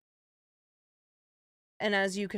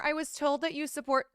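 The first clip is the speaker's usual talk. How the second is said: mock casual accusation; 6 semitones higher, faster than usual. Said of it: "Coming in here".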